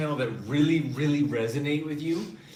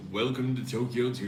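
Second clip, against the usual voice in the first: announcer voice; 2 semitones lower than usual. Shown as a demonstration. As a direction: welcome them like a posh butler. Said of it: "Tokyo Tuesdays with a voice".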